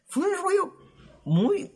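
sudden squeaky high fue un rollo; falsetto exclaim. Real, not acted.

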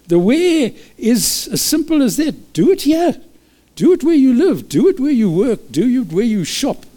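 high, ringing preacher's call; fervent preaching. Acted. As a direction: urge them on with rising fervor. Preaching at full force, high and loud.

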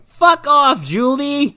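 put-on high, snotty teen brush-off; mock bratty teen. Friends brushing Julie off: high, snotty teen sneer. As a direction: sneer it like a rude teenage friend. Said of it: "And they're like".

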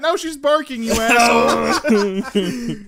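high, loud scolding, last word stretched; exasperated yell at a friend; loud exasperated complaint. Real, not acted. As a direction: raise your voice and drag out the insult. Complains the screech set off dog.

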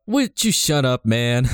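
a hint of Biden telling him to shut up; Biden impression. Gendo as Biden snapping at Shinji, light Biden voice. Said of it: "And Gendo Biden's like".

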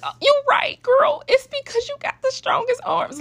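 proud mom's emotional squeal to her daughter; high emotional gush. Retold or imitated. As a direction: gush back at her, moved and delighted. Mom overwhelmed by daughter's answer.

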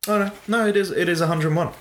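sudden high-pitched oh no, quickly; surprised realisation. Real, not acted. Realising mid-count he got the number wrong, surprised.

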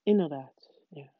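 soft, subdued mumble; mumbled. A narrator's reading. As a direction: agree meekly under your breath. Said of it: "mompelde meneer Duffeling". (zacht, bedrukt gemompel)

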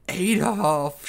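loud high cry of "Adolf!"; yelled name. Acted. Shrek cries out as his lover falls, yelling. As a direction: call out in shock. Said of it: "Shrek yelled".

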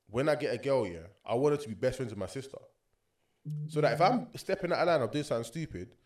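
ordinary talk, nothing marked

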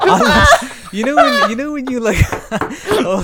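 high, loud, helpless laughter; cracking up, voice shooting high; laughing hard. Real, not acted. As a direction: try to explain through real laughter. Cracking up after botched accent.

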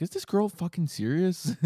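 high, slow disbelieving question; mock incredulous. Podcaster voices the spy's thought about the girl, incredulous.

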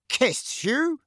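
high, rising disbelief; incredulous echo. A narrator's reading. Uncle echoes what Harry told him, incredulous. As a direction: shoot the pitch up in baffled astonishment.

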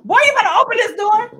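high, loud indignant demand; yelling. Retold or imitated. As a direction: holler it at the neighbor through the door. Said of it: "they were yelling at my neighbor".

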